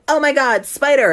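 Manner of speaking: high, loud mock shriek of fright; mock scream. Bully fake-screaming at the toy spider, loud and high. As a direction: fake a girly panic yell.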